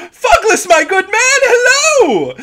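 high, loud theatrical rabbit shopkeeper; big drawn-out hello in a high, showy voice; showman's greeting. Acted. Tony greets a familiar customer, high showman's cry. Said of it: "there's a bit of showmanship".

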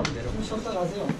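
ordinary delivery

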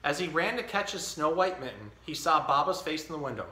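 put-on reading a picture book to a baby; story reading. Dad reading The Mitten to his baby, storytelling. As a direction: read it like story time.

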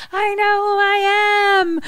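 high, drawn-out mock wail; freaking out. Mickey protests amid horrible flashbacks, acted freak-out. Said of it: "freaking the fuck out".

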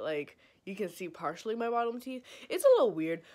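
lips catching on brackets; slightly awkward mouth movement; talking with braces on. Real, not acted. Seen 1: Metal brackets visible on teeth throughout; speaker mentions lips catching.

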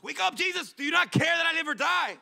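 high, frantic shout; panicked disciple. Pretend. We as disciples waking Jesus in the storm, frantic shout. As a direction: yell it like the boat is sinking.

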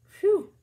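high, loud sigh of relief; relieved phew. Acted. Story over, relieved phew to the kids. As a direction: blow out the relief. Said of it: "I'm glad that didn't happen".

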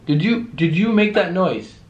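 high, loud question to the unseen; calling out. Real, not acted. Investigator asking spirit about a noise, calling out. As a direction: pitch it up and out into the room.

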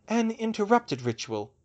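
high-pitched, pointed correction; correcting. A narrator's reading. Hermione corrects the healer about the botched ritual, pointedly. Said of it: "she corrected".

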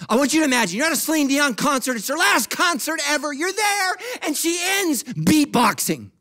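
high, loud scene-building; excited preaching. Real, not acted. Preacher making church imagine [name] concert, high and excited. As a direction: build the absurd story at full volume.

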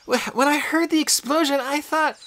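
put-on high girl's voice, faltering; a shaken friend stumbling over words; high, halting girl's voice; shaken Annabeth. A narrator's reading. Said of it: "looked pretty shaken up".

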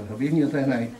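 ordinary delivery

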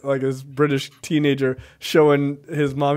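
ordinary talk

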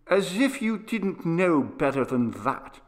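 put-on peevish, impatient boy's retort; pettish. A narrator's reading. Boy turning away from his father, pettish and sulky. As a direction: toss it off sulkily. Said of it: "with a pettish exclamation".

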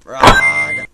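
very high loud yell; high furious screech; high shriek of rage. Real, not acted. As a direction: screech in fury. Raging gamer.

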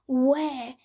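ordinary delivery, nothing marked

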